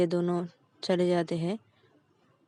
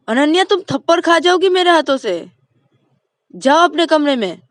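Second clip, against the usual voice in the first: higher and louder, furious order; angry threat; 8 semitones higher, 12 dB louder than usual. Acted. Mother threatening Ananya with a slap, loud angry order.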